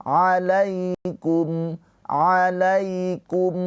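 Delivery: alaikum with the ya slid softly. Shown as a demonstration. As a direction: soften the ya of alaikum. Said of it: "dilembutkan ke huruf ya".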